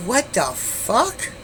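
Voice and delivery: slow, high, drawn-out question; stunned disbelief. Retold or imitated. Woman peeking in window sees a gun, stunned. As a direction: act out shocked disbelief, stretching each word. Retells seeing gun in his hand.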